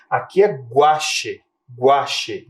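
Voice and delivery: "guache" slow with the U as w; teacher contrasting "guache" with "guri"; gw sound demo. Shown as a demonstration. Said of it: "a gente consegue perceber o som da letra G".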